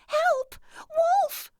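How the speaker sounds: very high, loud screaming call; shouting for help. A narrator's reading. Girl fleeing the wolf screams for help, very high and loud. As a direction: yell it out running for your life.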